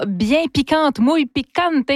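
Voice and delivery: put-on rolling a playful Spanish accent on muy picante; host hamming up Spanish flavour. Radio host teasing a spicy recipe, mock Spanish accent. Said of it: "des sifflements face à mon accent espagnol".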